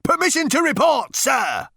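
high, loud parade-ground report; military shout. A narrator's reading. Number Two reporting to the captain, shouting. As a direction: shout it to a superior officer. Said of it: "cried number two".

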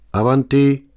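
high, loud call from inside. A narrator's reading. Voice inside answers the knock, calling out. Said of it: "rief eine Stimme von drinnen".

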